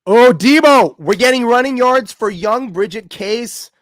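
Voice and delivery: loud, high excited shout of a bet update; thrilled live-betting call. Real, not acted. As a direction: burst in with the big news.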